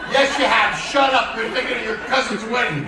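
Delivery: loud, laughing comeback, cutting her off; loud retort. Retold or imitated. Snapping back at the woman in line, loud and laughing.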